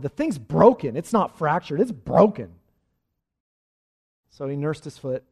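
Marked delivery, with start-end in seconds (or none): 0.0-2.5 loud, emphatic correction; Dizzy Dean retort. Retold or imitated. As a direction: hit each "broken" hard and loud. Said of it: "And Dizzy responded".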